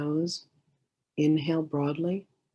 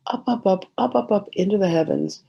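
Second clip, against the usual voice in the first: higher, louder string of ups; rising upward; 5 semitones higher, 6 dB louder than usual. Real, not acted. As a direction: lift the voice skyward on each up. Guides light up to heavens.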